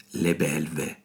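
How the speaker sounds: soft, dropped echo of "Le belve"; thoughtful murmured repeat of "Le belve"; quiet musing echo. Acted.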